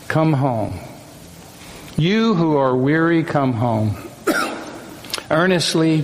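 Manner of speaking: spoken hymn, long pauses, phrases falling away; hymn recited as poem. Acted.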